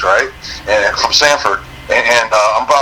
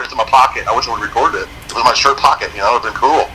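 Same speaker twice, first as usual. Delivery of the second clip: ordinary delivery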